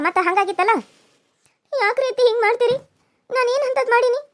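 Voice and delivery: ordinary delivery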